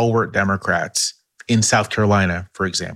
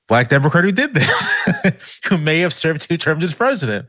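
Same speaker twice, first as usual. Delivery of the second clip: higher voice, breaking into laughs; amused laughter; 7 semitones higher than usual. Real, not acted. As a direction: laugh through a coy hint. Joking hint at a famous politician.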